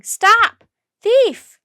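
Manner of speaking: high loud shriek. A narrator's reading. Crowd shrieking after Jack down the alley. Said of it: "Everyone kept shrieking".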